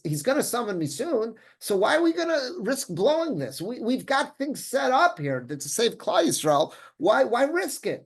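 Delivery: high-pitched acted objection; mock pleading protest. Acting out Esther's argument against risk.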